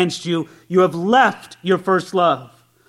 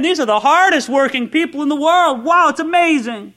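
higher, excited churchgoer gushing; impressed visitor; 7 semitones higher than usual. Pretend. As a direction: gush like a wowed first-time visitor. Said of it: "You'd say".

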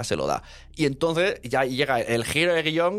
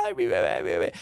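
mock wailing mother. Retelling the adoptive mother on the bell tower, high mock wail. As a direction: squeal it up high like a panicked mother. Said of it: "la madre adoptiva esta voluntaria gritando ahí".

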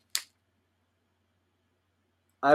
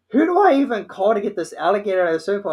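flustered dad; 5 semitones higher, 4 dB louder, faster than usual. Retold or imitated.

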